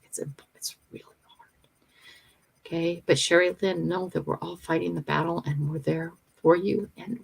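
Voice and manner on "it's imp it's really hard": whispered. Real, not acted. Reading viewer's painful message.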